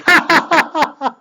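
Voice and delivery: very high, staccato fake laugh; imitated laugh. Storyteller shows the rabbi laughing at the lady, mock laughter. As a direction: act out a sudden high cackle. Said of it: "and then he laughs".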